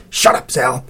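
tight, scolding hiss. A narrator's reading. Cece silencing Zal as the dog barks, hissing. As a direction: hiss a sharp rebuke at a friend. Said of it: "hissed Cece".

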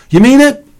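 very high, loud, eager question; hopeful child. A narrator's reading. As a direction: ask like a kid suddenly given hope. Hopeful child asks brother.

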